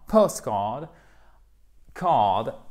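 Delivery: postcard, card with a long vowel in card. Shown as a demonstration. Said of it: "une voyelle longue".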